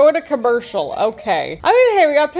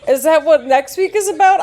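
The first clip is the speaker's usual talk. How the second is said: ordinary talk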